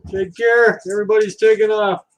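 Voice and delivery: usual speaking voice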